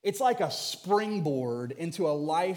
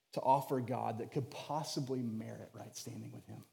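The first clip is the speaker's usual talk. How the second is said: softer, intimate preaching voice; hushed sermon; 7 semitones lower, 9 dB quieter than usual. Acted. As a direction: draw the congregation in close, softly.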